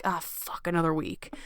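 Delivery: whispered, deflated sigh of a line; defeated groan. Retold or imitated. Imitating a listener dreading the week, breathy and soft. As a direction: let the air out of it, beaten. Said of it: "instead of feeling like".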